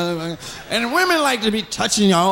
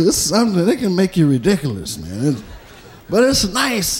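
ordinary talk

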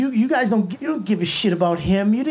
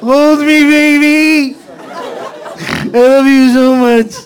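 high, very loud, drawn-out wail; mock emotional breakdown at full volume; hammed-up bawling; 8 semitones higher, 9 dB louder than usual. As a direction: bawl it out like a big baby. Acted breakdown in partner's arms.

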